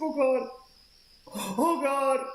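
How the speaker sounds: high-pitched imitation of a panicked man; mock freak-out. Retelling a guy tripping as a cat jumps on his windshield, mock panic. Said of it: "he, like, freaks out".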